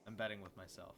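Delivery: ordinary talk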